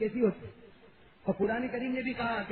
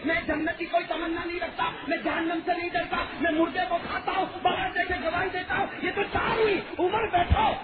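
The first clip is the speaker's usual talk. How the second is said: loud, higher shouted claims; defiant shout; 7 semitones higher, 4 dB louder than usual. Acted. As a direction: hurl each claim at Umar. Old man shouts his claims to Umar.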